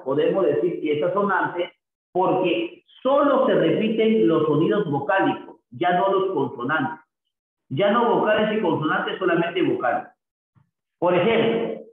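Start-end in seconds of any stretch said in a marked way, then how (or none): none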